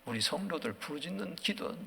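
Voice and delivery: ordinary talk, nothing marked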